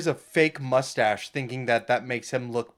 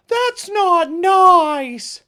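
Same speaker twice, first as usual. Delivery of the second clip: very high, very slow whine; drawn-out whiny bard voice; 9 dB louder, much slower than usual. Retold or imitated. Imitating the bard.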